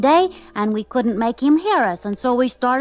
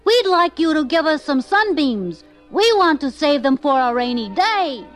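high, loud request sent skyward; calling out; 6 semitones higher, 5 dB louder than usual. A narrator's reading. Little pigs asking the sun for sunbeams, calling out. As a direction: project it up to someone far away. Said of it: "they called".

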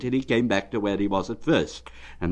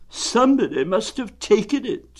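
gloomy Eeyore; 13 semitones higher, 5 dB louder than usual. Acted. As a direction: drag it out like a sad old donkey.

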